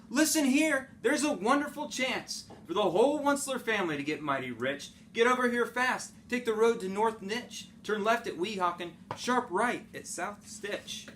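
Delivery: excited Onceler on the phone. Acted. Onceler phoning his family.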